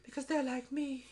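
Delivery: very high, soft put-on child voice; little clone girl. Clone girl freeing dinosaurs, mock little girl voice. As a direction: mimic a small girl in a thin falsetto. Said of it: "The little clone girl".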